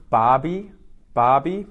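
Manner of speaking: "Barbie" loud, said BAR-bee; Barbie with a rising, announcing tune; teaching Barbie. Shown as a demonstration. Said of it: "the second one".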